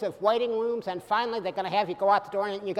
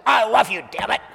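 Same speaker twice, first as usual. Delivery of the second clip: loud mock-angry declaration; shouting a love confession in mock anger; angry I love you; 3 semitones higher, 8 dB louder than usual. Shown as a demonstration. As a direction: bark it out like an exasperated order. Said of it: "You don't say".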